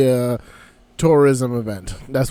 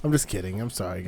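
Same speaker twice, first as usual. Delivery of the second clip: much softer sheepish apology; sheepish quiet; 9 semitones lower, 11 dB quieter, faster than usual. Real, not acted. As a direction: drop your voice, a little embarrassed.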